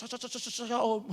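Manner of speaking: "Saya, saya, saya" rushed and repeated, tripping before "om"; fast stutter. Retold or imitated. Pastor showing his son rushing his words. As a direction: rattle off "saya" over and over. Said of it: "kalau ngomong cepet".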